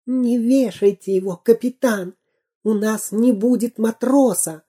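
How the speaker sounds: hint of a whisper; whispered plea. A narrator's reading. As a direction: plead in the captain's ear. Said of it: "Дырка зашептал ему на ухо".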